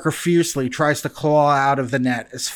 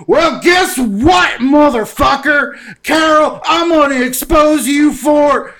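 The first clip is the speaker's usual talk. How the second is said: much higher and louder, slow ranting shout; booming Joe Exotic rant; 12 semitones higher, 8 dB louder than usual. Retold or imitated. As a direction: bellow it like an unhinged zookeeper. Joe Exotic booms over speakers.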